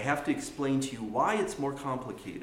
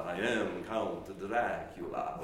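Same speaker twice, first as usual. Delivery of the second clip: slow, low, drawn-out vampire parody; Count Dracula; 4 semitones lower, slower than usual. Pretend. Teacher joking with students, mock vampire voice. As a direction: stretch the vowels like a hammed-up vampire. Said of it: "as a joke with my students, will do the, the Count Dracula".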